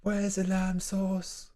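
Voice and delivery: high, slow, drawn-out chef voice; Gordon Ramsay impression. Streamer mocking a chef demanding lamb sauce, high and slow. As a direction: demand the missing sauce in disbelief. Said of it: "This is my Gordon Ramsay impression".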